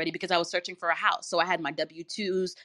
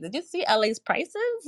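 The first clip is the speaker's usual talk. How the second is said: incredulous; 8 semitones higher than usual. Retold or imitated. Retold disbelief at prices.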